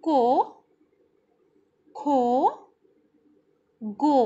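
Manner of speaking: loud, separate syllables with pauses; syllable drill. Shown as a demonstration. Teacher repeating syllables aloud for children. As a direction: drill each sound for the class. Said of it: "एक बार फिर से करते हैं".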